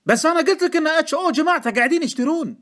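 very high, loud, frantic objection; angry protest. A narrator's reading. Informant repeating his warning to Deacon, angry shout. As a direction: shout it as if nobody listens.

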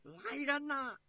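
high, enraged summons; storyteller's furious emperor calling out; exasperated roar. A narrator's reading. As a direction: bellow for the guards in a fury. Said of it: "气急败坏的宇文赟呐吼叫着".

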